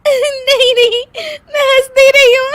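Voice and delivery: loud high squealed denial into long laughter; laughing denial. Acted. Daughter-in-law insisting to guest she isn't laughing, laughing. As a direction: deny it through helpless giggles. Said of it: "हंस क्यों रही हो".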